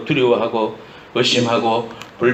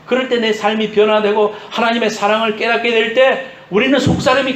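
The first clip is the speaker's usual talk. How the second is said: high-pitched, insistent sermon voice; impassioned preaching; 8 semitones higher, 3 dB louder than usual. Real, not acted. Preacher urging listeners to let the Spirit engrave it, impassioned preaching. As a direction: drive each phrase home to the congregation. Preaches with raised, urgent delivery.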